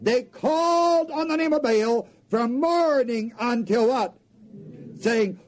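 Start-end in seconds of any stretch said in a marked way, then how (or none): none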